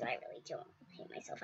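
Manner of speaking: fast breathy whisper, no voice. Real, not acted. Kid whispering to viewers that she doesn't hate herself. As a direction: hush it like a secret aside.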